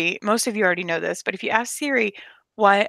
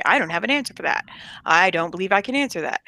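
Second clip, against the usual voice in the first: usual speaking voice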